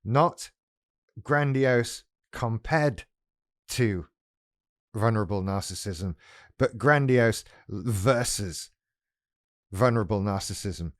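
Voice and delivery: slow, word by word, grandiose versus hit hard; drawn-out emphasis on versus; stressed contrast. Shown as a demonstration. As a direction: spell out grandiose versus vulnerable. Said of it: "I'm talking about the internal struggle".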